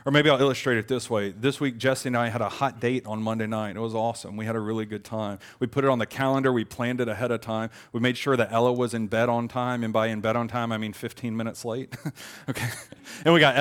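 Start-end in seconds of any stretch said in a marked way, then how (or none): none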